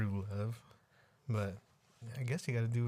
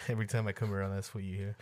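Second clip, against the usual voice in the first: ordinary delivery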